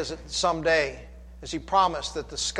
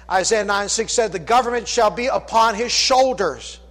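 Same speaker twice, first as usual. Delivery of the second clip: loud, high, driving sermon voice; full-force preaching; 6 semitones higher, 7 dB louder than usual. Acted. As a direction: call it out over the congregation.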